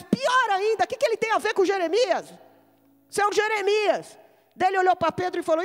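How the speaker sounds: high, ringing exhortation; full-force preaching. Real, not acted. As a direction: preach it out with conviction.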